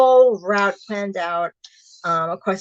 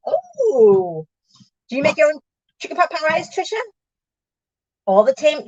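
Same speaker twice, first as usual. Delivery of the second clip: ordinary talk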